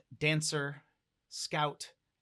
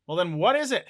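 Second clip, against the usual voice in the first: louder, higher, pressing question; blunt demand; 6 semitones higher, 10 dB louder, faster than usual. Acted. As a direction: demand the answer outright. Hellhound bluntly demands an answer.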